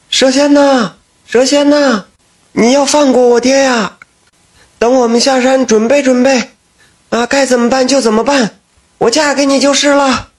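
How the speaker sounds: high pleading call up the mountain. Acted. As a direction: cry out to the snake spirit, begging. 立刻从山上喊道. (三女儿向山上高声哀求蛇仙)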